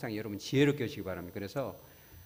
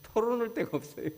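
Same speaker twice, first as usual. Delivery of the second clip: mock wail, pitched way up; exaggerated whine; 11 semitones higher than usual. Pastor mimicking the lonely man's complaint, hammed-up high whine. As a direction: repeat 털어놓을 데가 없어요 much higher, hammed-up lament. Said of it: "이렇게 얘기해요".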